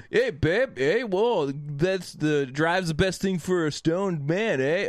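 playful Canadian accent impression, eh; Canadian stoner accent. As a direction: imitate a stoned Canadian buddy with a hint of Canadian accent. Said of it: "has an unreal Canadian accent".

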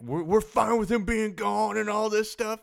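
loud, slow Boston fan rant; hammed-up Boston fan going nuts, poor accent; bad Boston accent. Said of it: "the Boston fans on Twitter are going nuts".

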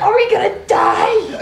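panicked. Acted. Panicked question.

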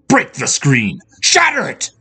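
high, building to a shout; escalating frantic order; urgent command. A narrator's reading. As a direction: urge her on fiercely. Spirit urges her to smash mirror.

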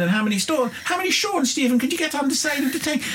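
put-on high, lively Irish accent. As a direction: tell it in a broad Irish accent. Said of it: "I'm about to do an Irish accent".

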